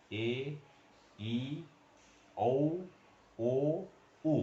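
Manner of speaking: "ए", "ई", "औ", "ओ", "उ" each said clearly and apart; vowel sounds demo. Shown as a demonstration. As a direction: model each vowel one by one for the class. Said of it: "ये इसका आवाज है". (स्वरों का साफ़ उच्चारण)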